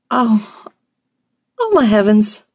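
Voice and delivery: high-pitched squeak of dismay; high, flustered little cry; startled squeak. A narrator's reading. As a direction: squeak it out, caught in horror. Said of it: "she squeaked".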